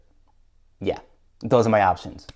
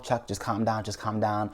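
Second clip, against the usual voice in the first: soft, hushed pep talk to yourself; calming self-talk; 8 dB quieter than usual. Retold or imitated. Anxious owner soothing himself in a hushed voice. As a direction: talk yourself down under your breath. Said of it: "I'm telling myself".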